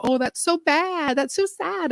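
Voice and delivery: high, pitying mock sympathy. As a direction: imitate people pitying you, high and sing-song. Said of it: "and they go".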